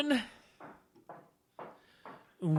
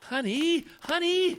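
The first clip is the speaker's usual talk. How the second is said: higher and louder, urgent pleading; panicked calling; 10 semitones higher, 4 dB louder, slower than usual. Acted. Husband stopping wife from the bedroom, panicked high call. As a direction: stop her before she opens the door.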